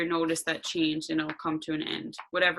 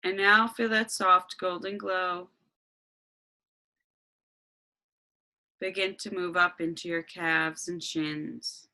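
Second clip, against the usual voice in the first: guided meditation. Acted.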